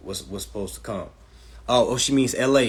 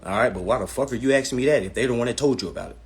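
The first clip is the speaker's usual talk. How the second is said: normal talking voice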